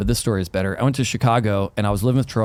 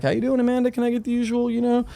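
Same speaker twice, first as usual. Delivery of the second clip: higher, sweet friendly greeting; friendly chirpy; 10 semitones higher than usual. Retold or imitated. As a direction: charm the barista sweetly. Ordering from favorite barista.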